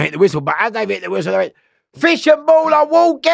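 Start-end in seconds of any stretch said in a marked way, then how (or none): 1.9-3.3 loud, high, held-pitch shout; imitated officer's yell, high and ringing; called-out announcement. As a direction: holler it like a call down the wing. Acting out officers' alarm call.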